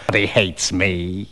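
low, soft, slow needling; rogue's hushed, teasing complaint; sly taunt. Acted. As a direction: drawl it quietly to provoke. Stranger taunting Macfarlane.

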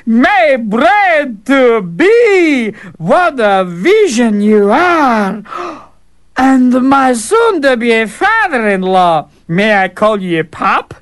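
high, very slow, hammed-up cheek; a brash fool savouring every word; cheeky fool. Fool flattering the princess and teasing the Tsar, slow and high.

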